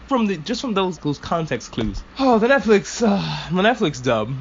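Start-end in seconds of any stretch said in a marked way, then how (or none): 2.2-4.3 groaning complaint, starting high then dragging; fed-up lament; exasperated. Real, not acted. Exasperated at dub's changed line.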